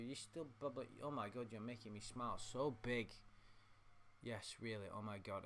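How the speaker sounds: ordinary talk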